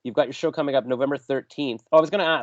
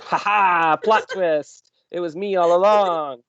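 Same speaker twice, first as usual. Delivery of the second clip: hammed-up gloating reveal, higher and louder; gloating plot-twist reveal, played for laughs; villain reveal; 6 semitones higher, 5 dB louder, much slower than usual. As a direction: crow it like a cartoon villain unmasking.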